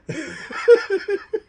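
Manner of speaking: real laugh pitched high. Real, not acted. Host laughs at chat comment.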